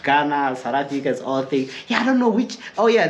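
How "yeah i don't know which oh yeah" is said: ordinary talk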